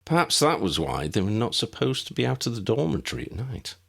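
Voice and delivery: usual speaking voice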